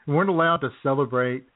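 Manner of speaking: normal talking voice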